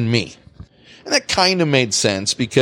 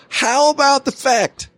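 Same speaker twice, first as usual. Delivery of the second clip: heated rant; 10 semitones higher than usual. Real, not acted. Ranting at the boss.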